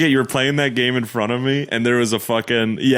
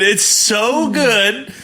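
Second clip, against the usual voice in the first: ordinary talk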